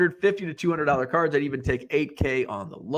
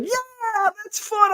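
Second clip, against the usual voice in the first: Kyle's easy yes; much slower than usual. Retold or imitated. Voicing Kyle agreeing.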